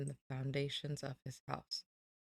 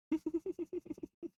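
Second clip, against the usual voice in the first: laugh; 14 semitones higher than usual. Real, not acted. Laughing at own mute mistake.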